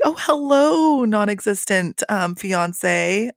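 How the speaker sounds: high, drawn-out fake-sweet hello; mock-sweet, high-pitched phone greeting; catty mock greeting. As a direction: greet them in a high, petty singsong.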